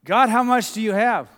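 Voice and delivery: mock exasperation at a hoarding uncle; exasperated exclamation. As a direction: throw up your hands and demand it. Asking uncle about his guitars.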